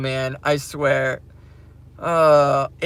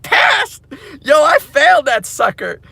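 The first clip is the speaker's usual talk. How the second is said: high, loud disbelieving yell; incredulous shout; 7 semitones higher, 5 dB louder than usual. Retold or imitated. Retelling his answer to the coworker, loud and incredulous. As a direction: shout it back in mock disbelief. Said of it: "And I was like".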